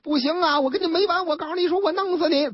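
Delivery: storyteller mock-voicing a furious son; drunken threat. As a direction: spit out the threat like a drunk hothead. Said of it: "疯了".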